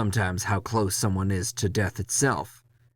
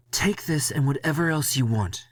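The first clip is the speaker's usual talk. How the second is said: Leslie's voice; 7 semitones higher than usual. Acted. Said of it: "he says".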